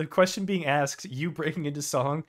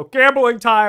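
loud, higher-pitched holler; big hype shout; called-out announcement; 7 semitones higher, 10 dB louder, slower than usual. Retold or imitated. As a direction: call it out to the whole lobby. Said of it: "I just go".

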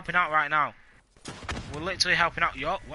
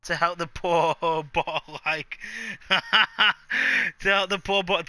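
giggling through the words; laughing; much slower than usual. Real, not acted. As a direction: laugh while reading it out. Laughing while reading a donation.

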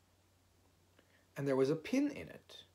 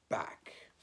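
ordinary delivery

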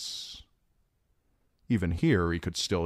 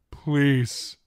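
high, louder falling plea; tearful plea; 8 semitones higher, 5 dB louder than usual. A narrator's reading. Theon begs the old gods, tearful plea. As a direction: beg desperately. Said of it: "Tears trickled down his cheeks".

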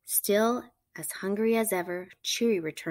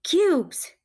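excited; 10 semitones higher, 7 dB louder than usual. A narrator's reading. Punchline of fun fact.

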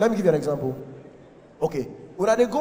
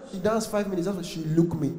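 usual speaking voice

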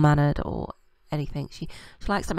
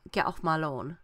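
mock dismissal; 4 dB quieter than usual. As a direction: get off my lawn in a lightly voiced, fed-up mum. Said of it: "She's like".

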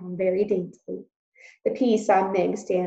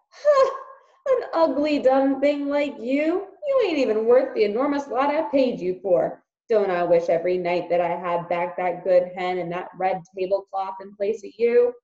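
mocking uncle; 6 dB louder than usual. Uncle mocks Meg cruelly.

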